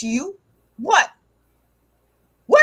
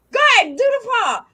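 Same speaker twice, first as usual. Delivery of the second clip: ordinary delivery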